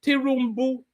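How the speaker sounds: Tinubu said as Tirumbu, a rolled r in the middle; mocking mispronunciation. Commentator mimicking protesters' mispronunciation. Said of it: "Did you hear what he was saying?".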